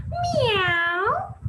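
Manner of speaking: put-on a high, playful meow for the kids; high cat meow. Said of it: "What does the cat do?".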